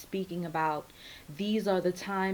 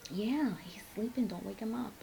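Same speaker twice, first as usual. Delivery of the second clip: whispering over a crib; 2 semitones higher, 8 dB quieter than usual. Real, not acted. Hushing kid near sleeping baby.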